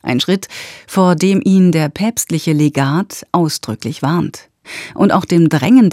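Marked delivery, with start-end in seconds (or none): none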